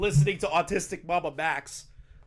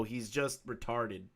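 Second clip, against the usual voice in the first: ordinary talk, nothing marked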